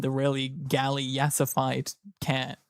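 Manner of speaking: ordinary talk, nothing marked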